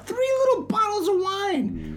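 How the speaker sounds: mock tiny voice, high and slow; high squeaky voice. Mocking tiny bottles.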